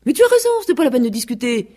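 high, fast, mocking rooster; insolent sneer. A narrator's reading. Vexed rooster pretends to agree with goose, insolent. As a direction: toss it off quickly with sneering insolence. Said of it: "avec un air insolent".

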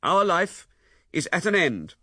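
loud, deliberate, final; emphatic anger. A narrator's reading. Husband declaring the end to his wife, loud and final. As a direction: make sure she cannot mistake it.

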